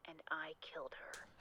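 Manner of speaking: breathy menacing whisper. Acted. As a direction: whisper the confession like a threat. Creepy caller ends in whisper.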